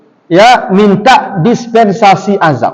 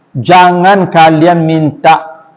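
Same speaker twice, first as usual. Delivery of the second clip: ordinary talk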